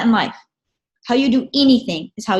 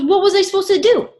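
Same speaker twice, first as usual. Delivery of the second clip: mock exasperated dad; 4 semitones higher, 4 dB louder than usual. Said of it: "He goes".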